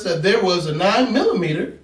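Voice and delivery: ordinary delivery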